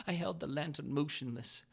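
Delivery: ordinary delivery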